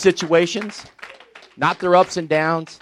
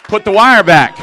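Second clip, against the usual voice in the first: emphatic preaching; 9 semitones higher, 9 dB louder than usual. Acted. Preacher driving home the point.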